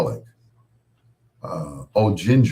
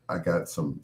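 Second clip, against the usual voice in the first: ordinary delivery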